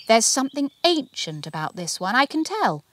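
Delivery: an expert marvelling aloud; wondering Bernadette. A narrator's reading. Bernadette to Archie, high and wondering.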